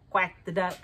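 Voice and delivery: ordinary delivery, nothing marked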